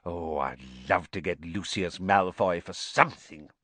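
relishing, grim wish; grim satisfaction. A narrator's reading. Mister Weasley longs to catch Malfoy, grimly relishing. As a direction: savour the thought of catching him. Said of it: "with grim satisfaction".

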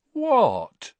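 overdone who-me question; fake innocent. A narrator's reading. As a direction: play innocent and fool nobody. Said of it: "in an innocent tone that deceived nobody".

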